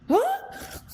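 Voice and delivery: high, rising startled squeak. Retold or imitated. Angry driver hearing she agrees with him, startled. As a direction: imitate being caught completely off guard. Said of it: "you could see he was startled".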